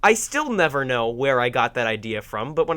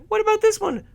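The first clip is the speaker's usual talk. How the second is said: high, louder mock parent; confused parent; 5 dB louder than usual. Parent pointing out another toy, confused. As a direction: pitch it way up, a baffled parent asking. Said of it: "imagine how confused parents would be".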